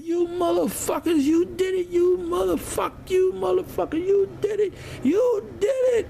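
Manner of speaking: imitated cursing rant in a very high voice. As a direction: mimic a derelict's high-pitched accusing shouts. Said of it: "He just started cursing us".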